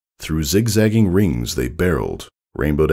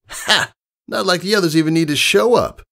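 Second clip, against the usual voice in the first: high, cocky tomboy voice; high brash tomboy pony; brash pegasus; 14 semitones higher than usual. Acted. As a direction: play the confident young flyer explaining. Rainbow Dash voiced in story.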